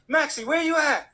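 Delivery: high, eager phone greeting; excited friend calling. Retold or imitated. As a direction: call out brightly to a friend.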